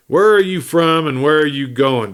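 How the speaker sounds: deputy questioning the stranger, demanding. A narrator's reading. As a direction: press him for an answer, mildly stern. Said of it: "demanded the other".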